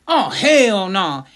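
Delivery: loud, slow, stretched-out outburst; emphatic refusal. Retold or imitated. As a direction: imitate someone waking up to flat-out refuse. Friend wakes and refuses arrest.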